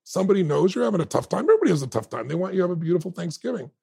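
delivery man at the door pleading with the proud father. Retold or imitated. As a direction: plead gently with the angry dad. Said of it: "the man said".

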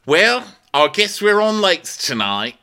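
high, loud, slow declaration; exaggerated put-on crewmate voice; [name]. [name] reacting after Kyle's fall, high slow mock announcement. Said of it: "[name] goes".

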